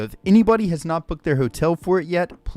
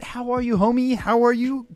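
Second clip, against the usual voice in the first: ordinary delivery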